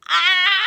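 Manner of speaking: high, loud startled cry; a sudden loud high yelp; giant's shout. A narrator's reading. Giant Og crying out on seeing the coat, high and loud.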